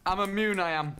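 put-on northern English accent. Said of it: "You're talking normally now".

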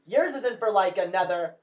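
ordinary talk

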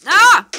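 sudden loud high cry of shock; startled scream. Real, not acted. Gamer hit by surprise, startled yell. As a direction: yelp as the bullet hits.

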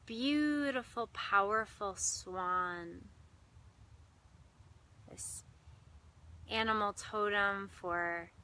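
measured meditation voice with long pauses; guided meditation. Real, not acted. Meditation host guiding listeners into breath and heart, unhurried guided meditation. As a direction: guide listeners inward, lingering on key words and leaving silences.

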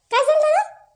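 high, loud astonished call; startled question. Acted. भाई का हाल देखकर पुकार.

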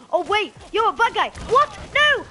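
loud, high alarm; panicked shouting. Real, not acted. Gamer discovering a bad guy up close, panicked yelling. Discovers an enemy beside them.

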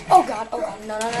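normal talking voice

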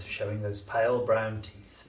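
plain reading voice

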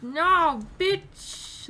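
loud taunting snap. Real, not acted. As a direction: taunt the enemy high. Taunting the enemy.

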